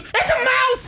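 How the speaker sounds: very high-pitched retold shriek; mock scream. Wife spots the mouse in the box, mock high scream. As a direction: imitate a panicked squeal, far above normal pitch. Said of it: "And then screamed like a little bitch".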